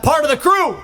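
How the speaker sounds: high shouted crowd call. Real, not acted. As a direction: yell it to rally the crowd.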